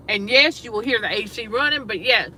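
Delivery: ordinary talk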